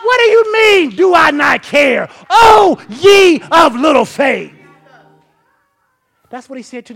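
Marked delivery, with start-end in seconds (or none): 0.0-4.5 much louder, higher shouted rebuke. Acted. Preacher as Jesus rebuking the doubters, shouting. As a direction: throw the question back, full force.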